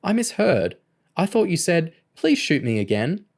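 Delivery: high-pitched mock innocence; grinning taunt. A narrator's reading. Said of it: "Kerry grinned".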